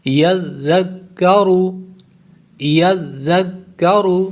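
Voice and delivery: یذکرو recited slowly, ya joined to zal and zal to the doubled kaf; tajweed recitation. Shown as a demonstration. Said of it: "زال چونکہ نرمی سے پڑھا جاتا ہے".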